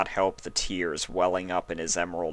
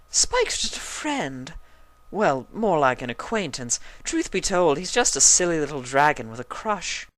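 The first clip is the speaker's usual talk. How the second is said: high, airy dismissive; dismissive Rarity; 9 semitones higher than usual. A narrator's reading. Rarity brushing Spike aside, high and airy. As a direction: wave it off lightly.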